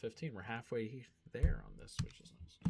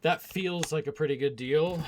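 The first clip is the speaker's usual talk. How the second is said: emphatic; 7 semitones higher, 11 dB louder than usual. Real, not acted. Repeats phrase louder, emphatic.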